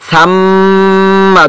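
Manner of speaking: সাম্মা with a long, steady hum on ম; held ghunnah. Shown as a demonstration. Teacher showing students how long to hold, সাম্মা with prolonged ম. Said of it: "ধরে রাখতে হবে".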